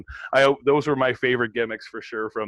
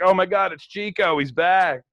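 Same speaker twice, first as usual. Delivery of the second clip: excited recognition; 7 semitones higher, 6 dB louder than usual. Retold or imitated. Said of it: "I was like".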